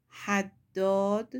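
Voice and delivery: حداد read slowly and loudly; slow Arabic word. Shown as a demonstration. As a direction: stretch out حداد slowly, loud and clear. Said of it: "آهنگر".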